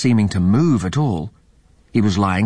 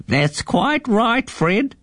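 put-on high, slow old eagle voice; lazily agreeing old bird man; 14 semitones higher, much slower than usual. A narrator's reading. The old eagle agrees with Fred the mouse, slow and high.

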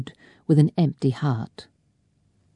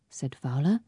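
usual speaking voice

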